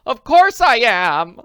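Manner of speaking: loud, high, slow indignant shout; loud outraged narrated scream. A narrator's reading. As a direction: hurl it at the doubter. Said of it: "Missus Willoughby screamed".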